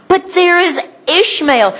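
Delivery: high, pleading voice; pleading Abraham. Retold or imitated. Abraham pleading with God for Ishmael, pitched high. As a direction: beg it like an old father. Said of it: "what did he say next".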